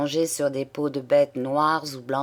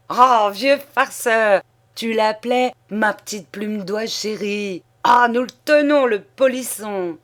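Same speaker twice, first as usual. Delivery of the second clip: gleeful shout; 5 semitones higher, 6 dB louder than usual. Acted. Cacelin teasing the old man, loud gleeful shout. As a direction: crow over the old man, choking with glee. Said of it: "suffocant, criait".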